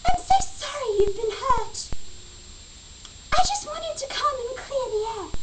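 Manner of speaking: high-pitched mock apology in the rival's voice; irritating chipmunk voice. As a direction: mimic her maddening squeak. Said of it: "most irritating voice I've ever heard".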